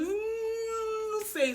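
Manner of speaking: high, tearful whimpering answer; patient crying an answer to the nurse. Retold or imitated. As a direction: sob out the words in pain. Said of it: "Eu gritando".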